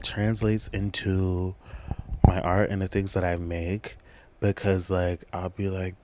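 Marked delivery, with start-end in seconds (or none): none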